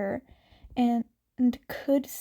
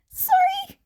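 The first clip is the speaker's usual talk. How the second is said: high, loud squeaked apology; panicked yelp; 8 dB louder than usual. Acted. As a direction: yelp it out as you bolt. Izuku blurts apology, flees.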